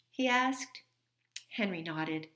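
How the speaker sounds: usual speaking voice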